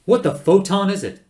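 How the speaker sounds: loud, high demanding question; exasperated yell. Acted. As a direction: demand an answer at full voice. Zaphod yells at the computer.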